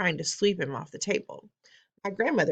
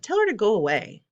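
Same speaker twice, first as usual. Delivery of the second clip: higher, louder snooty lady; haughty wife; 6 semitones higher, 5 dB louder than usual. Acted. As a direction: brush the old woman off. Mrs Jenkins dismisses grandmother.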